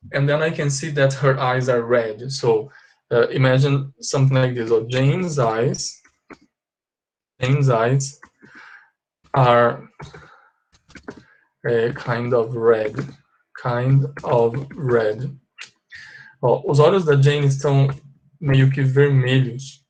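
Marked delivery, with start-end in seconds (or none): 4.7-15.3 slow, low, with long gaps between words; slow dictation. Shown as a demonstration. Teacher writing out an example for students, slow dictation. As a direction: dictate it bit by bit, drawn out.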